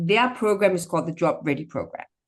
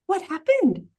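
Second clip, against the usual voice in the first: What happened rising much higher in amazement; astonished, voice jumping up on what happened; high amazed question; 12 semitones higher than usual. A narrator's reading. Old woman marvels at clear view.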